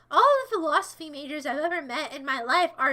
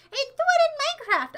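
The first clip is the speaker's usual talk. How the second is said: much higher, whiny mock voice; high mocking whine; mocking sissy voice; 11 semitones higher, 6 dB louder, faster than usual. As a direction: imitate a weenie, high. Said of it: "I'm not a sissy that's like".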